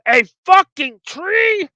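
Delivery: a high, loud, slow outraged yell. Retold or imitated. Man realising he is a tree, a slow outraged yell. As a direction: yell it out in disbelief. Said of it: "he literally yells".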